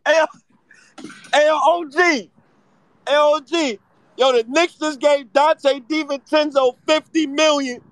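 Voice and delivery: high, loud shout across the room. Real, not acted. Host shouting the signing news to the OG. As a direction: yell the news at him.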